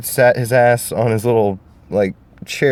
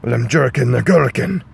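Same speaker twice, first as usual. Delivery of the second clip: Shrek voice; 3 semitones higher than usual. Acted. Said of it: "Shrek was like".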